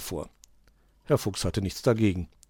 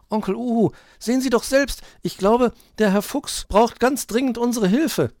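put-on high, loud child figure pleading; urgent little Borstel; 7 dB louder than usual. A narrator's reading. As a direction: urge your uncle to come and help. Borstel urges the owl to look. (drängendes Kind, hoch und laut)

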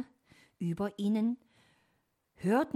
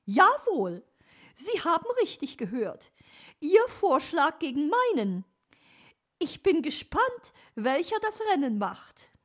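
loud, very high bird-lady voice; challenging magpie; 10 semitones higher, 9 dB louder than usual. Acted. Magpie challenges fox to compete, high emphatic voice.

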